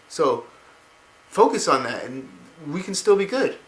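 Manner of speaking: put-on high childlike voice; boy's voice. Boy pleads with Arthas, high childlike voice. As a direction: talk it up high like a hopeful kid. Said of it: "the boy's like".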